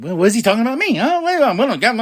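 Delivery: mock Becky ranting to Seth; imitating Becky. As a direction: rant in a put-on Becky voice. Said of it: "Becky's just going on like".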